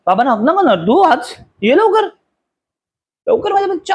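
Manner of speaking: cutesy, high cajoling; playful coaxing. Real, not acted. Coaxing upset child for a hug.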